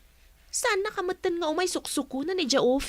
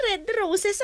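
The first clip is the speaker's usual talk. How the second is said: high, excited squeal; thrilled by the gift; high giggling surprise; delighted squeal; 6 semitones higher, much slower than usual. Acted. Delighted at roses delivery.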